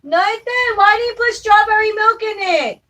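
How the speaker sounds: high, loud exasperated scolding; scolding yell. Real, not acted. To Nathan about the baby's milk, a loud scolding yell. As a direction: yell at him for getting it wrong. Scolding son over the drink.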